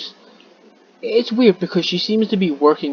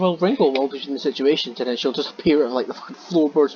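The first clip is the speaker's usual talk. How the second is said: normal talking voice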